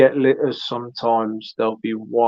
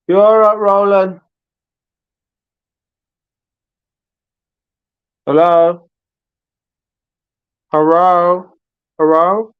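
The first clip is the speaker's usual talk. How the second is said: loud, higher calls of hello; calling out loud; 6 semitones higher, 7 dB louder than usual. Real, not acted. Host calling loudly to Roland on the stream. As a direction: shout out to get Roland's answer.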